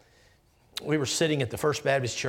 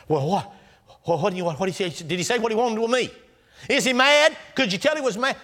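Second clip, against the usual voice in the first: anxious questions; 8 semitones higher, 4 dB louder, faster than usual. Acted. Jacob frightened questioning servants.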